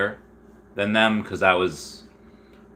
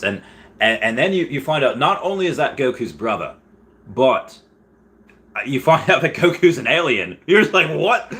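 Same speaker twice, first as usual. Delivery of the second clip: put-on Australian accent, rising higher and louder; 5 semitones higher, 3 dB louder than usual. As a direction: get worked up in a mock Aussie accent. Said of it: "gonna go back to English now".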